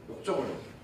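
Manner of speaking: 역정을 dropped low and soft; soft low echo of 역정을; quiet repeat. Shown as a demonstration. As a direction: echo 역정을 under the breath.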